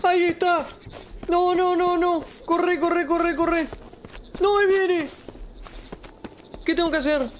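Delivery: high, panicked and breathless; being chased. Real, not acted. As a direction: run for your life, panicking. Fleeing the clown in game.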